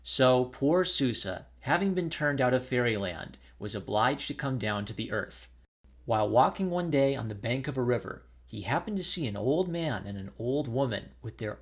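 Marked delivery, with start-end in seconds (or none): none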